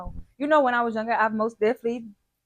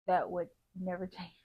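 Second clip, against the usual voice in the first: trying not to laugh; stifled giggles; 3 semitones lower, 7 dB quieter than usual. Real, not acted. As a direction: giggle breaking through the words. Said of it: "I'm trying not to laugh".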